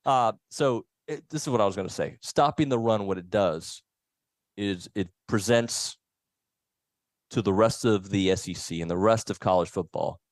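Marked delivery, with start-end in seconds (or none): none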